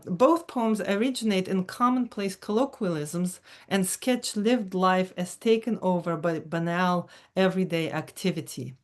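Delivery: ordinary talk, nothing marked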